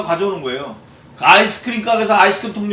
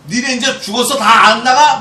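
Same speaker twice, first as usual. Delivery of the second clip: higher, louder taunt at enemies; threatening shout; 7 semitones higher, 8 dB louder than usual. Acted. Psalmist driving out the evildoers, loud threatening taunt. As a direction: threaten them off. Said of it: "막 이렇게".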